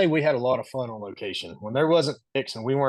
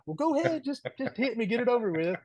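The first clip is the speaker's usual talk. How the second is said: taunting dare; 11 semitones higher than usual. Retold or imitated. Taunting scared coworker.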